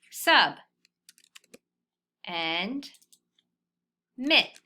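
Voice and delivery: Sub and mit said apart, loud; chunking the word; syllable by syllable. Shown as a demonstration. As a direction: spell out Sub, then mit. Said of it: "fill them in with my review sounds".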